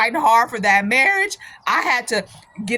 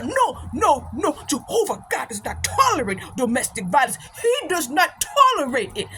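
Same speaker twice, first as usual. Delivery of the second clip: mock African-accented sermon; African brother's accent. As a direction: preach it like an African brother, each word weighty. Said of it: "going on and on and on".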